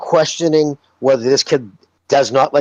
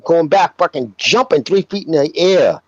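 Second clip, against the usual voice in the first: ordinary talk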